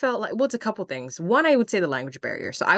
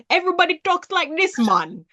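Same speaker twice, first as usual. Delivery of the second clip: higher, mock Jamaican accent; Sebastian the Crab; 11 semitones higher, 3 dB louder than usual. Mocking classmates' idea of Jamaicans, fake Jamaican accent. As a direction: put on a cartoon Jamaican accent. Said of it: "sound like Sebastian the Crab".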